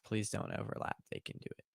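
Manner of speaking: soft whispered repeat of the chat message; breathy, unvoiced whisper. Shown as a demonstration. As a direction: whisper the message back to himself.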